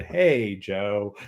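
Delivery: a mild, falling Hey, Joe; imitated salutation. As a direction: let Joe sink after a drawn-out Hey. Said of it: "all the different versions of just the salutation".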